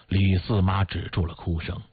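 ordinary delivery, nothing marked

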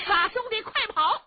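high shouted warning; urgent shout. Acted. Qin Qiong calling back to his brother, high shout. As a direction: yell to him to run.

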